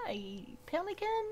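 high-pitched hello to a critter; friendly animal greeting. Real, not acted. Player greeting a pelican, friendly and high-pitched. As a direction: greet it like a little buddy.

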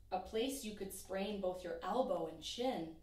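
ordinary delivery

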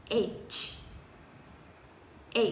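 ordinary talk

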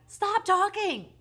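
high, slow full-voiced bellow; father's bellow. A narrator's reading. Father cuts off his chattering son, bellowing. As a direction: bellow each word, stretched out. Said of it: "bellowed his father".

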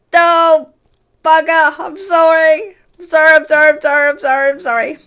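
high, loud frantic sorry, fading down; panicked apology. Real, not acted. Panicked apology to the angry pigmen, high and loud. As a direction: beg for mercy in a panic.

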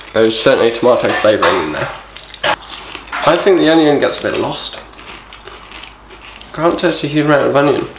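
talking while eating; chewing crisps. Real, not acted. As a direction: mouth partly full. Seen 0: Speaker holding the bag and bringing crisps to his mouth while commenting.

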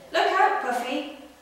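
very high, very loud warning shout. Acted. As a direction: yell to save your friend. Barry warns Puffy of crate.

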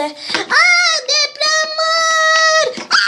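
very high, long-held shriek; hammed-up panicked scream; screamed alarm. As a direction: scream the warning, stretching the last word. Acted earthquake alarm.

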